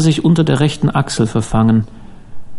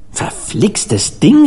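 drawn out, higher-pitched exclamation; exclaiming; 7 semitones higher, much slower than usual. A narrator's reading. Harvey cursing the snagged fishing line, exclaiming. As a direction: call it out slowly, pitch jumping up. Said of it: "rief er".

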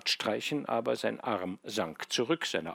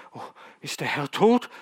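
trembling voice; 6 semitones higher, 9 dB louder than usual. Acted. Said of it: "mit zitternder Stimme".

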